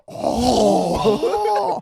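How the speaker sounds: high, loud exclaimed "Oh" in a put-on accent; a sudden hammy accented "Oh". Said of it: "you go straight into".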